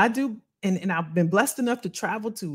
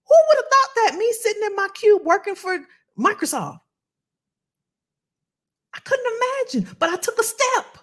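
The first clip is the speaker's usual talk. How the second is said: high, amazed testimony; excited disbelief; 8 semitones higher than usual. Real, not acted. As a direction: marvel at it, pitched way up.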